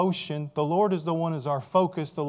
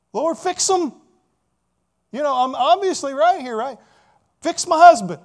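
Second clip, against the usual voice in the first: much louder and higher, exasperated demand; demanding prayer; 10 semitones higher, 12 dB louder than usual. Retold or imitated. Worshipper demanding God fix their husband, loud and high. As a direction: shout your complaint to God.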